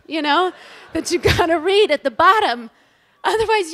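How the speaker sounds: ordinary talk, nothing marked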